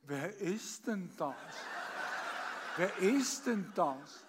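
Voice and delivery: lively storytelling recital, acting out each voice; comic poem recitation. Reciting a comic poem for the birthday audience, performed recital.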